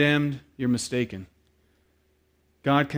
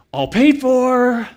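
high, drawn-out holler; gleeful announcement; 10 semitones higher, 8 dB louder, much slower than usual. Retold or imitated. Manager to customer about free food, long high holler. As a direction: sing it out loud across the counter.

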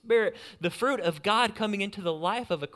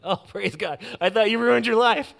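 relieved, loud laughing exclamation; laughing relief; 7 semitones higher, 10 dB louder than usual. Retold or imitated. Pastor retelling his relief at a friend's pregnancy news, laughing loudly. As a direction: laugh it out, weight off your shoulders. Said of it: "I'm like".

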